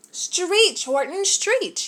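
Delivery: lightly voiced bug yelling orders; drawn-out bossy shout of stretch. A narrator's reading. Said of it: "yelled the bug".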